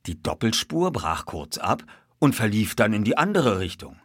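ordinary delivery, nothing marked

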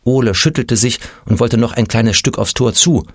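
ordinary delivery

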